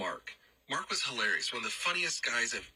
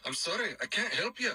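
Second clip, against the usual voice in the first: put-on high, tight Irish accent; 9 semitones higher than usual. Roommate rebuffs his coworker's dad, Irish accent. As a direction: imitate an uptight Irish roommate. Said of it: "pretty good job with that accent".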